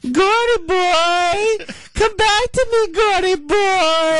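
very high, slow breathy woman's voice; high sultry female impression; Linda Lovelace. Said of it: "that's, uh, Linda Lovelace".

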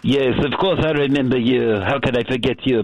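ordinary delivery

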